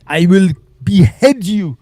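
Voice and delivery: hammed-up Pakistani accent, drawn out; mock Pakistani accent. Chat troll threatening the streamer in mock Pakistani accent. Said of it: "sharpening his scimitar".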